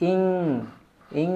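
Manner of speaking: ইং hummed through the nose; ikhfa nasal demo. Shown as a demonstration. Teacher showing how the nasal letter sounds. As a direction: ring the ng of ইং in the nose. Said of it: "এভাবে উচ্চারণ হবে".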